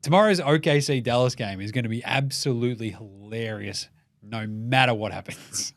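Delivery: usual speaking voice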